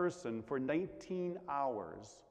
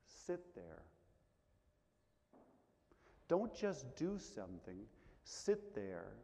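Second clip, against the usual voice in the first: softer, lower, calming advice; flipped, calm; 5 semitones lower, 5 dB quieter than usual. Shown as a demonstration. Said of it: "we need to flip that around".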